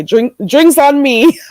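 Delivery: laughing. Real, not acted. Jokey offer to stream viewers.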